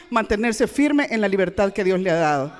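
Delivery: usual speaking voice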